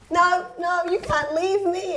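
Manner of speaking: high-pitched, acted-out motherly plea; high mock-distressed protest; mum's pleading protest. Acts out mum refusing to let go.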